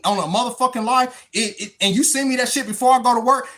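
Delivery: normal talking voice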